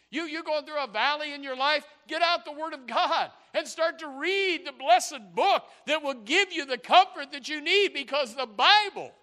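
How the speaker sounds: high, loud pulpit exhortation; full-force preaching. Real, not acted. Preacher urging people in a valley to open the Bible, full-force preaching. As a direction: drive each phrase home to the congregation.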